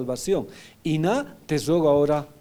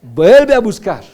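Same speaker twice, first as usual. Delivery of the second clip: loud, slow, commanding call of God; God's command; 4 semitones higher, 13 dB louder, slower than usual. Retold or imitated. God ordering Hosea back, loud and slow. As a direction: boom out "Vuelve a buscar" as God's order. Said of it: "qué le dijo Dios a Oseas".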